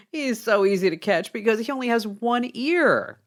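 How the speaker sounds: lightly acted airhead, pleased with the answer; smug second trainee. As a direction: chuckle first, then answer breezily. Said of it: "The second guy smiles, flips his hair".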